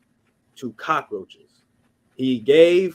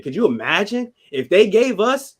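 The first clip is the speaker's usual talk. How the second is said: indignant outburst; 7 semitones higher, 5 dB louder than usual. Real, not acted. Indignant rhetorical question.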